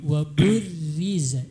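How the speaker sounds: burriza slowly, with a light untrilled R; thin R. Shown as a demonstration. Judge correcting the child, slow thin R in burriza. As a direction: draw out burriza, keeping the R thin. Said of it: "Harusnya tipis ini".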